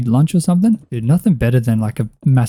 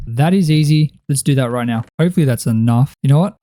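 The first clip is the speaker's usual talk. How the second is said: ordinary delivery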